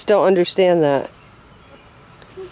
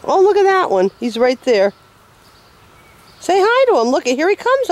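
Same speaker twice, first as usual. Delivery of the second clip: excited; 7 semitones higher than usual. Real, not acted. Excited talk to child about chicken.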